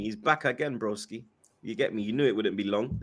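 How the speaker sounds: ordinary talk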